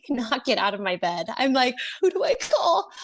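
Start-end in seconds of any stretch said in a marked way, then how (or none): 2.0-2.9 high-pitched mock panic; panicked. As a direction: re-enact a helpless panic. Retelling her panic.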